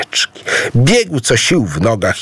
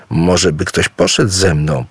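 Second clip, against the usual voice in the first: ordinary delivery, nothing marked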